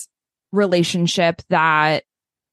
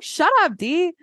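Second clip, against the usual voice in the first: mock exasperated; 9 semitones higher than usual. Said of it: "it's like".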